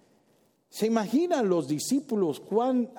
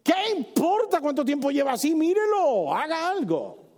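higher, pleading desperate father; 10 semitones higher than usual. Acted. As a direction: beg him to act now. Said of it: "Se puede imaginar al padre".

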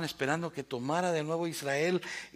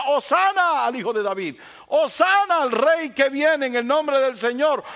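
crowd shouting; 12 semitones higher, 11 dB louder than usual. Retold or imitated. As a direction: call it out like the festive crowd. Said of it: "los mismos que gritaban". (la multitud aclamando a gritos, alto y lento)

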